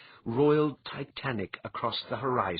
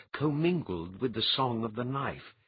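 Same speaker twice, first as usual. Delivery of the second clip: usual speaking voice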